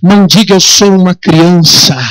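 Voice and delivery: slow, weighty reading of God's words; solemn scripture reading. Acted. Preacher to congregation, voicing God's call in a slow, solemn declamation. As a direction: declaim each promise slowly, like a verdict from heaven.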